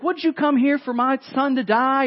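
hammed-up accusing outburst at the prophet; mad widow. Widow lashing out at the prophet over her son, mock angry. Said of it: "but she's mad".

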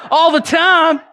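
joyful call-and-response. Retold or imitated. Preacher answering a stranger at the gas station, joyful. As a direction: answer with a happy laughing amen.

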